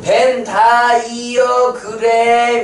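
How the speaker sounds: slow, singsong teacher emphasis on each syllable of "벤 다이어그램"; drawn-out term drill. Shown as a demonstration. Drilling the term after asking class.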